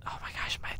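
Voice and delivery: whispered. Real, not acted. Connection drops.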